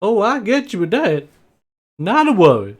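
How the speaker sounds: high, swooping farm-folk voice; friendly farm host. Acted. Farm host putting a guest at ease, high and friendly. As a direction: reassure the guest in a high character voice.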